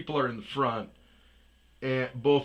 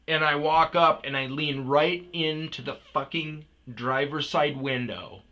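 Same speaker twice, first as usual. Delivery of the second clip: ordinary delivery, nothing marked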